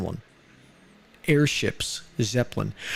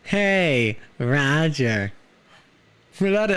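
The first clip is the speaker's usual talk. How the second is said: put-on very old man; slower than usual. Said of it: "the old man voice".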